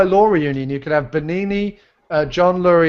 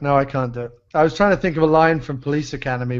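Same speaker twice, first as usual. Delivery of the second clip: ordinary talk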